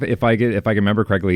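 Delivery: ordinary delivery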